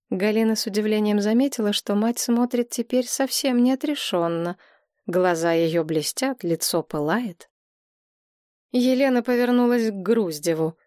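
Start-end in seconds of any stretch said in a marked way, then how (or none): none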